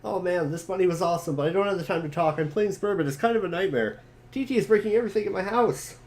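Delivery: panicking about the incoming meteor; excited character voice. Acted.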